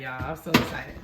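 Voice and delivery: ordinary delivery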